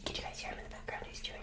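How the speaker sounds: fast, hushed whisper; quick excited whisper to listeners. Real, not acted. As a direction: whisper so you don't drown out the chewing. Whispers so dog's chewing is heard.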